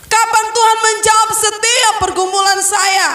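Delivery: high, pleading, urgent prayer; pleading cry to God. Retold or imitated. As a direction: cry out to God, begging for an answer. Preacher voices desperate prayer cry. (memohon dengan suara tinggi)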